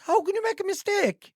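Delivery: very high-pitched disbelief; incredulous. Real, not acted. Podcaster challenges AI assistant's excuse, incredulous. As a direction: squeak the question out, utterly incredulous.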